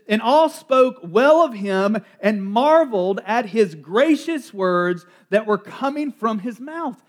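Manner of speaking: slow, deliberate reading of the verse; scripture reading. Acted. Preacher reading the verse to his congregation, slow and measured. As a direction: weigh each word of the gospel.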